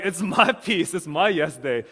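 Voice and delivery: playful laughing boast to his wife. Retold or imitated. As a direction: tease her through a laugh. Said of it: "And I'm like".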